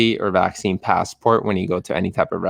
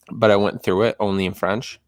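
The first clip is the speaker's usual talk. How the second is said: usual speaking voice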